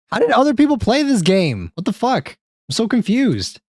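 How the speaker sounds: high-pitched baffled outburst; exasperated confusion. Real, not acted. Streamer fighting the game's settings, high exasperated confusion. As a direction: voice shoots way up in bewilderment.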